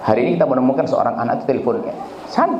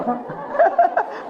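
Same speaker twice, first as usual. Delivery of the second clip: high burst of laughter. Real, not acted. Preacher laughs at own joke.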